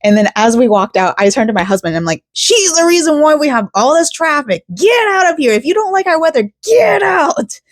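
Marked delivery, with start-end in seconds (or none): 2.3-7.6 much higher, fed-up hammed-up rant; exasperated mock rant. Griping to her husband about the cashier, hammed-up exasperated rant. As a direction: pitch shoots up in indignant disbelief.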